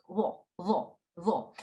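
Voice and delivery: lo with a thick rounded th sound; thick rounded letter. Shown as a demonstration. Teacher demonstrating her lo to the class. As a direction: say lo as a heavy rounded th. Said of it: "I make it T H".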